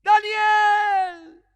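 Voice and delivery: loud, high anguished calling out of a name; desperate king calling into the pit; anguished shout. Acted. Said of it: "gritó con angustia".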